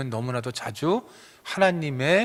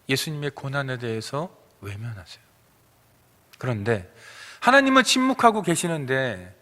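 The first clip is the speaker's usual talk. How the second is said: ordinary talk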